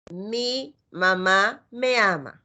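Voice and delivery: "Mi mamá me ama" read very slowly, word by word; slow model sentence. Shown as a demonstration. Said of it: "escribimos oraciones". ("Mi mamá me ama" leído muy lento)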